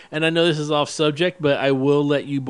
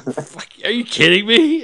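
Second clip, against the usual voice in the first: higher, louder retold outburst of disbelief; mock disbelief; 6 semitones higher, 4 dB louder than usual. Host retelling his reaction to a fan, in mock disbelief. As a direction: act out the shock of hearing it. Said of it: "I was like".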